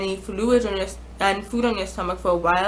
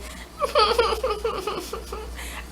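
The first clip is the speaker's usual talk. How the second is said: high, soft mock sobbing; crying noises, much higher and softer than speech; imitated crying; 13 semitones higher, 6 dB quieter than usual. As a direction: act out a run of wordless sobs. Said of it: "I cry".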